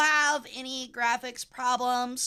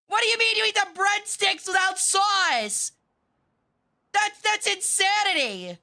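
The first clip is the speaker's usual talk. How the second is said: mock outrage; 6 semitones higher than usual. Real, not acted. Mock outrage over breadsticks.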